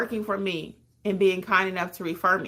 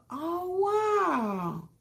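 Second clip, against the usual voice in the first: overwhelmed wow; 10 semitones higher than usual. Real, not acted. Moved by viewer's confession.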